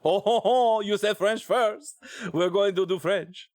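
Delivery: high, hammed-up French accent; over-the-top mock-French voice; terrible French accent. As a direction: chortle and protest in a bad French accent. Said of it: "my terrible French accent".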